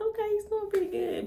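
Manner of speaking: high, light imitation of her own relief; high relieved mom. Mom in the waiting area, in a high relieved voice.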